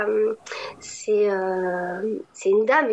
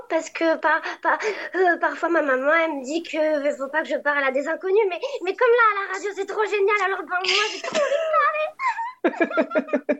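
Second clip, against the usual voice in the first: high childlike cartoon voice, much higher; little girl voice; 7 semitones higher than usual. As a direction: play a chatty little girl. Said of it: "peux nous faire une impro".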